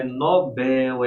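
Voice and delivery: "Nobel" slowly, as no-BÉL; drawn-out no-BÉÉL for "Nobel"; stress on BEL. Shown as a demonstration. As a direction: hold the last syllable of "Nobel". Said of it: "Porque é Nobel".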